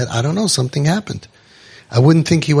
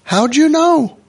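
mock amazement; 13 semitones higher, slower than usual. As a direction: overplay the surprise. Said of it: "F you, drop dead".

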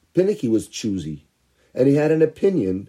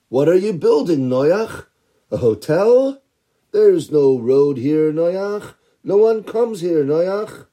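mocking villagers; 6 semitones higher, 4 dB louder than usual. Mocking people question Noach.

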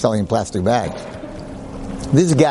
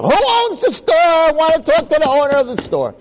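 high, loud put-on voice of a pushy customer; demanding customer; 3 dB louder than usual. Joking about customers who want the owner, high demanding voice. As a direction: squeal it out like an entitled shopper.

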